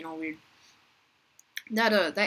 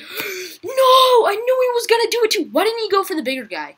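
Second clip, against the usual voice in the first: much higher and louder, crying out in dismay; anguished shout; 14 dB louder than usual. Real, not acted. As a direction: wail it as you get eaten. Gets eaten, cries out.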